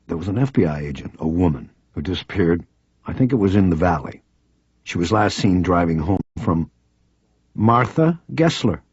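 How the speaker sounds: plain reading voice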